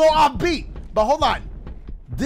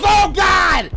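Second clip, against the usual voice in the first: loud, high panicked cry for the character; voicing Dash; 7 semitones higher, 8 dB louder than usual. Player voicing his rider Dash, panicked shout. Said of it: "Dash goes".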